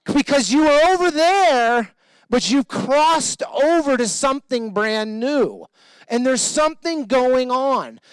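fervent pastor preaching over the crowd. Acted. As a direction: preach it big to the congregation. Preaching at full force.

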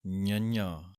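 ඤੰඤਾ said nyə-nyaa with palatal nasals; nasal nyanya. Shown as a demonstration. Teacher demonstrating how ඤੰඤਾ is pronounced. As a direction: sound ඤੰඤਾ with ny onsets. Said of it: "ਇਸ ਦਾ ਉਚਾਰਨ ਇਸੇ ਤਰ੍ਹਾਂ ਹੀ ਹੈ".

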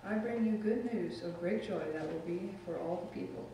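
normal talking voice

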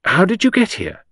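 very high, loud astonished question; startled question. A narrator's reading. Henrietta questions the unexpected Poirot, startled. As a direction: demand it in sharp surprise.

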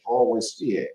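ordinary talk, nothing marked

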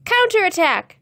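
high, loud rallying cry; child's war-game yell; opposing battle shout. A narrator's reading. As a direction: shout back at the other side. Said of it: "came an opposing shout".

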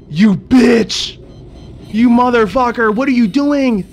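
high, loud shouts and a gasp; furious shouting. Real, not acted. Gamer yelling at creature stealing his Seamoth, furious. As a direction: yell at the thief.

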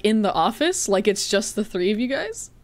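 ordinary talk, nothing marked

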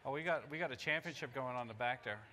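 normal talking voice

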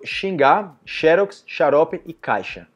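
"xingar", "xerox" and "xarope" with the X as sh, said clearly; repeated model words. Shown as a demonstration. Teacher repeats the X words for students. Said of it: "Então repetindo".

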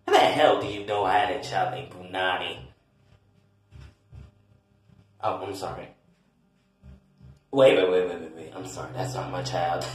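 low voice, caught off guard; flustered parent character. Acted.

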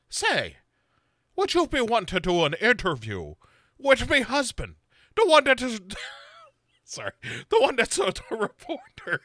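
put-on Irish accent. [name] as Sarah in a silly Irish accent asking about an interview. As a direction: lay on a thick stage Irish accent, pitched high. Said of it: "react to the accent".

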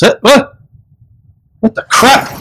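high-pitched baffled what; shocked disbelief. Real, not acted. Startled by strange emails, high surprised cry. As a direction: squeak it out, totally thrown.

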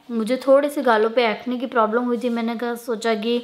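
mask on the face; through a sheet mask. Real, not acted. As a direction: lips under wet sheet. Seen 1: Sheet mask covers her whole face, mouth hole open, while speaking.

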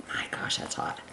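hushed gasp at the heat; whispered exclaim. Real, not acted. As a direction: breathe it out under your breath. Handling hot squash, whispering.

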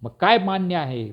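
high-pitched exasperated demand; restrained screech of frustration; shriek. A narrator's reading. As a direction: cry out the question, pitched well up. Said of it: "प्रांत किंचाळला".

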